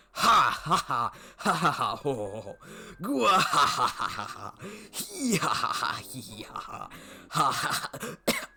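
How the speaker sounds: loud, high, over-the-top villain cackle; maniacal laugh. Acted. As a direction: cackle like a gloating rich man until your jaw aches. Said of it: "Oh, my jaw is tired".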